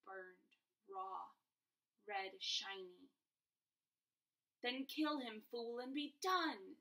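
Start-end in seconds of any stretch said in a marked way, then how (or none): 4.6-6.8 loud, higher screech. A narrator's reading. Voldemort orders Quirrell to kill Harry, screeching. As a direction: spit the order out. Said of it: "screeched Voldemort".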